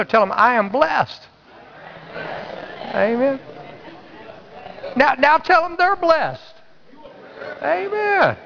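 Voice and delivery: very high-pitched preacher's exhortation; ringing high call to the crowd; convincing, rallying declaration. Real, not acted. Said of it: "You're gonna convince that person beside you".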